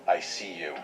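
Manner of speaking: soft ghostly voice from outside; hushed, distant and menacing; muffled creepy voice. A narrator's reading. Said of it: "the muffled voice from outside".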